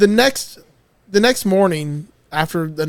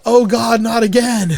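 higher, louder imitated cry of dismay; mock yell; 5 semitones higher, 5 dB louder, slower than usual. Retelling Barry waking up naked, mock yell. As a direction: yell it as a quoted character. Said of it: "And he yells".

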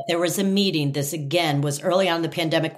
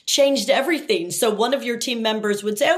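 normal talking voice

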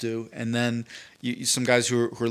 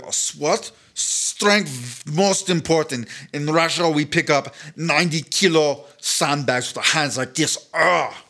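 put-on Russian accent; 3 semitones higher, slower than usual. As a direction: growl it like a tough Russian judoka. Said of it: "Jamal the Russian shows up".

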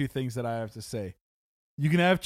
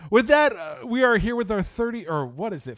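higher and louder, hyped up; big announcer energy; 7 semitones higher, 5 dB louder than usual. Real, not acted. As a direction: kick off the sign-off with a boost. Loud show sign-off.

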